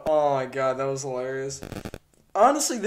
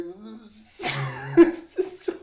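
laughing; 5 semitones higher, slower than usual. Real, not acted. Reactor cracking up at sketch.

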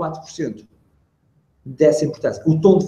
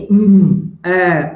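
drawn-out "hum, ah"; stalling hum and ah; filler sounds; 2 semitones higher, 6 dB louder than usual. Shown as a demonstration. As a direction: demonstrate long hesitation noises. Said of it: "os enchimentos".

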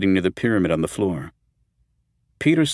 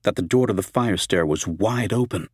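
usual speaking voice

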